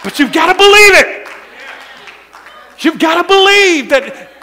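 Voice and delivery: loud, rising preacher's cry; full-force preaching. Acted. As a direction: drive it home to the congregation.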